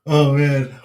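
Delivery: normal talking voice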